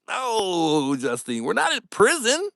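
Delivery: high-pitched mock objection; joking protest. Real, not acted. Streamer rejects a viewer's group name, joking protest. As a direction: protest playfully, voice climbing toward a laugh.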